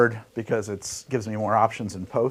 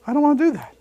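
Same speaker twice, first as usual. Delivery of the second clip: higher take; 4 dB louder, much faster than usual. Retold or imitated. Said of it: "as Chris Bowden said".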